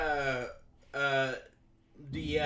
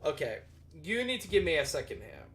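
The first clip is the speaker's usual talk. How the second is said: usual speaking voice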